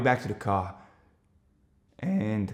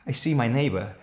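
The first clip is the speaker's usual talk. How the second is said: plain reading voice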